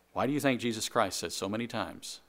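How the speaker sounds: usual speaking voice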